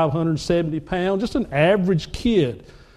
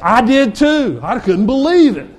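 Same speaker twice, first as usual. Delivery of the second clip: amazed; 4 semitones higher, 6 dB louder than usual. Real, not acted. Amazed at goal.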